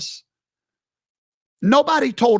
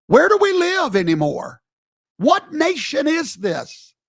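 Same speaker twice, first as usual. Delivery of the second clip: outraged cry; 8 semitones higher than usual. Real, not acted. Outraged at the church protest.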